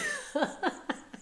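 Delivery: soft high-pitched giggling fit; amused laugh. Real, not acted. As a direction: keep giggling at yourself. Laughing at own accent slip.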